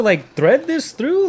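high, slow bewildered question; confused question. Retold or imitated. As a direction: drag it out, baffled. Puzzled retelling of his own question.

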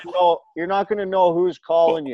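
can barely get the clerk's words out for laughing; amused retelling of a store clerk; laughing through quote. Retold or imitated. Retelling Apple guy's bad news.

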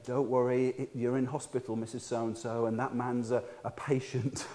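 soft, low imitation of hospital staff; gently calm a confused patient; soothing reassurance.

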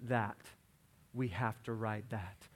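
put-on softly, weighing each word, as a child spellbound; imitating a small girl's hushed demand; awestruck little daughter. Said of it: "she looks at me and goes".

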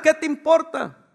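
high, drawn-out comeback; mock retort. Acted retort, repeated. (réplica fingida, aguda y alargada)

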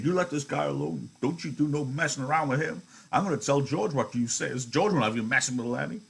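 put-on old man warning the wife off Lenny; old Candy protective. Reader voices Candy.

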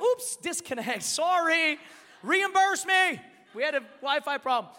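high, loud fake excuse; mock apology. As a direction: feign innocence about a dropped call.

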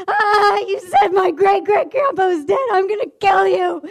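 high, wailing mock tantrum; retold screaming. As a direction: shriek like an enraged pupil. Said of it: "she was screaming again".

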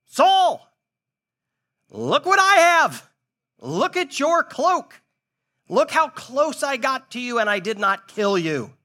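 high, loud call across the valley. Retold or imitated. David yelling to Saul across the valley, calling. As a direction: shout after him from the cave. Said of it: "he yells to Saul".

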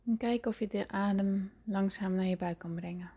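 hushed and calm, breathy at times; soft spa whisper. Acted. Leads a guided meditation.

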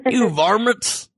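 slow, high cartoon cowboy; Yosemite Sam voice. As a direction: growl it like an angry cartoon cowboy.

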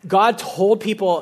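normal talking voice

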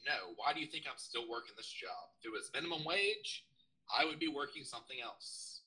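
normal talking voice

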